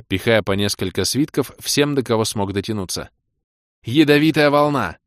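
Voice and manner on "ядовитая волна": loud, high spell shout; battle call. A narrator's reading. Caster unleashes a poison wave, shouting it.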